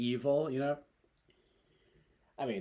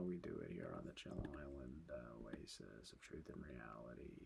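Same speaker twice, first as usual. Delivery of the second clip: whispering; 10 semitones lower, 18 dB quieter than usual. Pretend. Mock grand island name.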